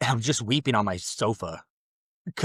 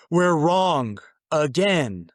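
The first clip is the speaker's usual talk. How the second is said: stunned announcement; 3 semitones higher, 6 dB louder, much slower than usual. Retold or imitated. Bursts out to his wife after reading.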